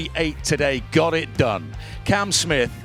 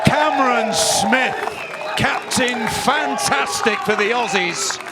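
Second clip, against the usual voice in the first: excited call; 8 semitones higher than usual. Real, not acted. Celebrating the made putt.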